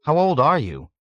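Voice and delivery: Nately leans in, curious; young man's curious question. A narrator's reading. Young man asks old man, intrigued.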